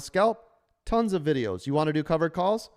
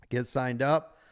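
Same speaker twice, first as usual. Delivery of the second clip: normal talking voice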